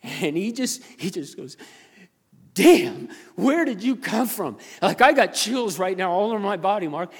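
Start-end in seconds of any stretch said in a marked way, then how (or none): 2.5-7.1 loud, high-pitched retold amazement; astonished. Store worker amazed by pastor's insight, acted astonishment. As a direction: marvel at it, voice lifted and strong.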